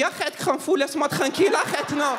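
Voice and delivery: put-on high-pitched comic German accent; shrill-pitched mock German refusal. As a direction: protest as a fridge with a heavy German accent. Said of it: "les blagues avec l'accent allemand".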